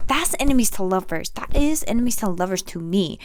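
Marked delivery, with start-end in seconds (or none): none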